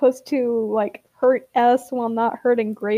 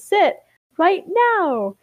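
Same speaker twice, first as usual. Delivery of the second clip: higher, drawn-out order; insistent, raised-pitch command; firm command; 7 semitones higher, much slower than usual. A narrator's reading. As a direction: order it like scolding a pet. Ash orders Grape to sit.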